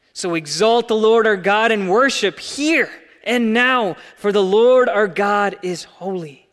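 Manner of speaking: loud, high rising call to worship; preaching at full force. Acted. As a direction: lift the whole room with it. Preaching climax.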